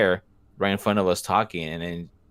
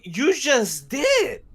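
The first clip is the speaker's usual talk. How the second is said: high, slow comeback; slow deadpan retort; 4 dB louder, much slower than usual. Retold or imitated. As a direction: drag out the comeback. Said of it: "And I'm like".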